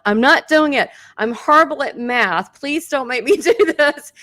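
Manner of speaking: loud, pleading protest, retold; arguing. Medium protesting to Einstein about the math, arguing. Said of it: "I started arguing again".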